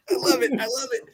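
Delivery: laughing, quick and high. Real, not acted. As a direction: laugh through the words. Laughing at the story.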